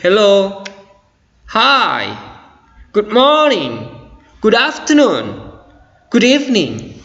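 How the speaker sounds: high, drawn-out greetings with lively intonation; spirited greetings. Shown as a demonstration. English teacher showing students how to greet with spirit, spirited. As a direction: lift each greeting high, full of enthusiasm. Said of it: "Supaya kesannya ada spirit, ada semangat".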